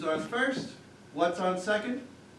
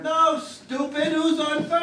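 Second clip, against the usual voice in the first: higher, louder, slow and emphatic; exasperated shout; 7 semitones higher, 7 dB louder, slower than usual. Acted. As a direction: spell it out to a fool. Manager loses patience with reporter.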